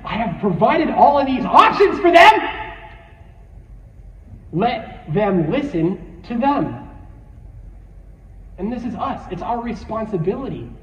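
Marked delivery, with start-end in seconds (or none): 0.1-6.9 mock divine exasperation, raised voice; exasperated God. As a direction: build to an exasperated shout. Acting God, exasperated at the plea.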